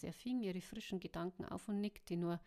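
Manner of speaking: plain reading voice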